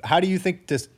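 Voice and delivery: ordinary delivery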